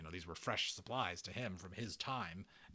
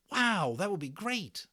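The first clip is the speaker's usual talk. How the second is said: put-on thrilled boy; 11 semitones higher, 7 dB louder than usual. Voices Omri.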